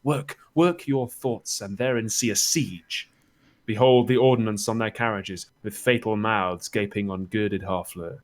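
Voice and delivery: rousing, declamatory verse reading; Shakespearean Chorus. Acted. Chorus urging the audience to imagine the fleet, declaiming. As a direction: paint the scene for the audience, leaning into key words.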